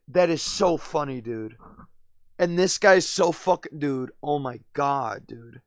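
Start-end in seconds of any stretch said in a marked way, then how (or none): none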